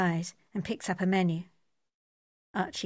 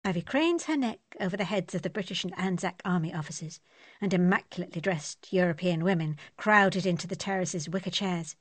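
ordinary delivery

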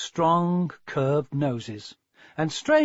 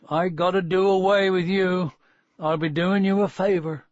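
higher, emphatic country farmer voice; rustic farmer, blunt; 4 semitones higher, 3 dB louder than usual. Acted. Farmer telling the ugly puppy it must go, blunt. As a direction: play a plain-spoken farmer with rural speech.

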